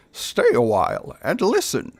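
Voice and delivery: a hint of a wise old storyteller; lightly voiced old sage; Deckard Cain. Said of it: "I guess I'm Deckard Cain now".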